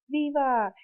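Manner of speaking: high, loud rallying cry; governor's shout. Retold or imitated. Teacher imitating the governor's midnight cry, loud and high. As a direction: call it out like the governor from the balcony. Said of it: "the governor comes out ... and yells".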